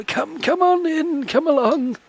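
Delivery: put-on high, soft, slow old man mutter. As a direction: mumble it like a frail old man. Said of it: "muttering off to himself".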